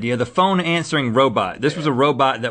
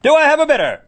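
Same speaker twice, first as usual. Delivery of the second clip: loud, high auctioneer patter; mock auctioneer calling out prices; 8 semitones higher, 4 dB louder than usual. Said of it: "I just wanna talk like an auctioneer".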